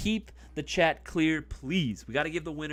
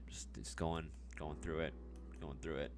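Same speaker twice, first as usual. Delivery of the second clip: ASMR voice; 12 semitones lower, 12 dB quieter than usual. Acted. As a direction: draw it out softly like an ASMR video. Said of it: "Do you guys like my A S M R voice?".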